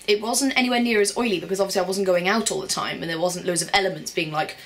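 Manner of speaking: usual speaking voice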